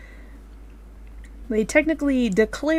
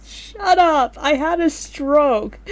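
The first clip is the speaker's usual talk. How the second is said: laughing; 6 semitones higher, 7 dB louder, much slower than usual. Real, not acted. Loud laughing retort.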